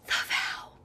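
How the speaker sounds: breathy whisper of the title; whispered confession. Real, not acted. Host whispers her embarrassing movie pick to friends. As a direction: whisper it like a guilty secret. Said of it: "And I'm scared to say this".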